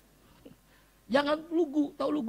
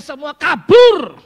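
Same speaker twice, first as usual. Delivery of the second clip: slow, booming shout; sudden loud shout; 7 semitones higher, 22 dB louder, much slower than usual. Acted. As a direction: throw the words at the hall. Punchline of sermon point. (teriakan keras dan lambat)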